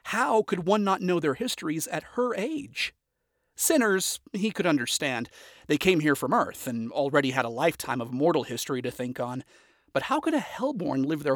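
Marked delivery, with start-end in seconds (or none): none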